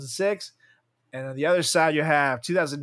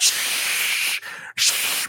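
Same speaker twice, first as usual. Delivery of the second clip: shushing; 4 dB louder than usual. Real, not acted. Hushing during technical trouble.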